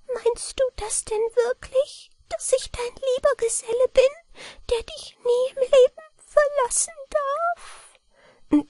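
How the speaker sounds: a tiny man asking with fragile hope; high feeble gnome voice; weak mannikin voice. A narrator's reading. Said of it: "Mit schwacher Stimme fragte".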